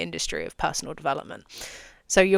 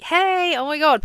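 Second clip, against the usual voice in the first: much higher, louder gushing welcome; thrilled crowd; 10 semitones higher, 6 dB louder, slower than usual. Pretend. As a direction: cheer like fans as the speaker walks in. Excited crowd greets the speaker.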